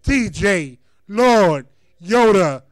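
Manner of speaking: ordinary delivery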